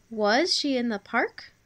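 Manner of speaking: careful dictated question with "park" rising at the end. Shown as a demonstration. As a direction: repeat the question, voice climbing on "park". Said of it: "your voice would go up at the end".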